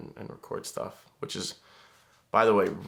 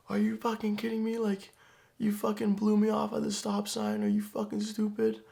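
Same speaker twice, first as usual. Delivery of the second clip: much higher, mock furious yell; angry driver yelling. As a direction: play the enraged driver ranting high-pitched. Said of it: "He was like".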